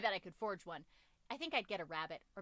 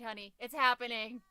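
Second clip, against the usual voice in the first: normal talking voice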